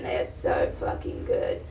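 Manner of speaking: very low, soft creaky murmur; low confidential undertone; hushed savouring. Acted. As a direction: drop to a near-whisper and relish it. Repeats line in dropped intimate voice.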